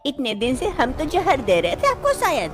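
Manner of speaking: the jealous wife needling her husband about the tea; jealous mother-in-law. Acted.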